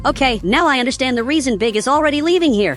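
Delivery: usual speaking voice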